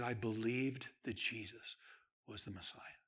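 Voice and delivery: soft, low, near whisper; whispered conversion moment; hushed confession. Retold or imitated. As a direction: breathe it out in awe.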